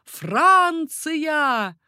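high, loud, ringing cry; shouted cry. A narrator's reading. Young rider gallops into the courtyard, shouting. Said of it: "крича".

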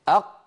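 أق with a hard closed qaf stop; stopped qaf. Shown as a demonstration. Teacher demonstrating أق with a closed stop for students. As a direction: say أق with the qaf fully shut, no release. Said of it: "أيوة، جربوا هكذا".

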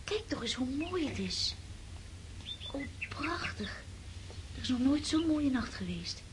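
hushed, breathless whisper at the moonlight. Acted. Girl at the window whispering to Sonja about the moon. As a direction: whisper it in awe.

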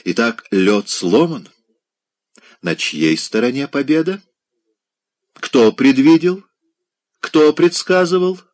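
gloat, climbing up to a high crow; a hint of a gloating society lady; triumphant lady. Triumphant lady's voice.

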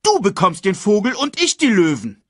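high, loud counter-claim; insistent quarrelling hyena; heated bickering. A narrator's reading. Acted quarrel over the prey.

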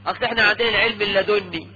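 normal talking voice

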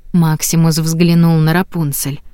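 usual speaking voice

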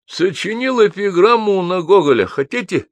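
high-pitched eager babble; jabbering drunk. A narrator's reading. Drunk Гнедич offers an epigram, jabbering. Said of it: "затараторил Гнедич".

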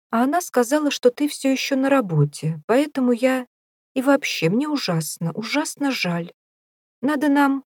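high, insincere sympathy; fake-sweet high voice. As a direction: coo with false pity. Derek's falsely sweet farewell.

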